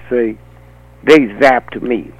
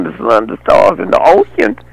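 ordinary talk, nothing marked

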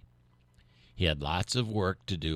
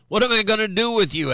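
much higher, louder, quick exasperated question; exasperated farmer; 9 dB louder, faster than usual. A narrator's reading. Farmer despairs over his flat turkey, high and exasperated. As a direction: throw up your hands at the turkey. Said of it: "asked Farmer Francis".

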